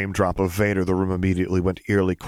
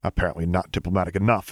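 usual speaking voice

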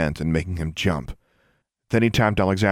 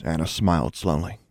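usual speaking voice

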